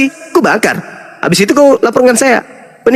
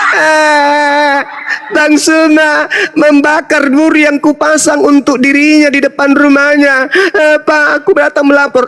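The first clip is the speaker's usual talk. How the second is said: mock whining complainer; 5 semitones higher, slower than usual. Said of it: "kau lapor ke polisi".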